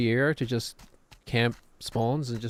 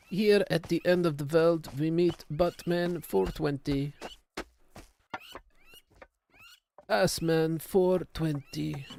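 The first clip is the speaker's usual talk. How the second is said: put-on slow, higher Herzog-like European accent; generic European accent; 6 semitones higher, slower than usual. Streamer resumes his documentary bit in a European accent. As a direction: drawl the names in a grave European accent. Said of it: "There's this generic European accent".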